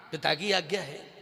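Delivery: low-pitched, subdued acted reply; subdued repeat. Kaushalya's dialogue with Ram on father's order, subdued confirmation. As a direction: say it low and settled.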